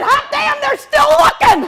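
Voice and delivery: high, loud gleeful cry; delighted shout. Retold or imitated. Speaker crowing to Nancy, high and loud with delight. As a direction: crow it out with glee. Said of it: "I said".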